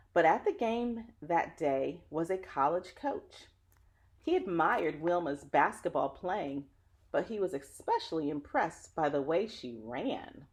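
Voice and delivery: put-on lively read-aloud voice for children; story narration. Reading a picture book to children, lively storyteller. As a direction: tell the story warmly to young listeners.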